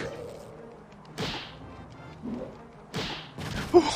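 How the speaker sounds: high laughing in shock; high, disbelieving laughter; startled laugh. Real, not acted.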